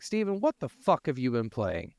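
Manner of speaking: ordinary talk, nothing marked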